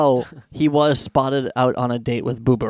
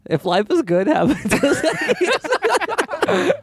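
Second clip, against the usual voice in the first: much higher, very slow, laughing slogan; ad-man slogan voice; 12 semitones higher, much slower than usual. Pretend. Quoting the Dos Equis ad for friends, mock slogan. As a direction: stretch the beer slogan out grandly. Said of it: "What's his famous quote".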